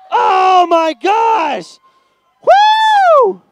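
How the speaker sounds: high, loud celebration; real excitement, shouting; excited shout. Real, not acted. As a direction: cheer the record time. Celebrating new record time.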